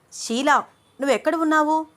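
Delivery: high, loud, urgent shout into the phone. A narrator's reading. Shweta shouts at Sheela on the phone, panicked yelling. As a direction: yell at her assistant in panic. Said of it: "అరుస్తూ మాట్లాడుతోంది శ్వేత".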